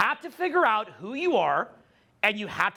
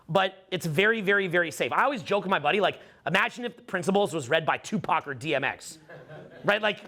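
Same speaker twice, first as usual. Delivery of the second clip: ordinary talk, nothing marked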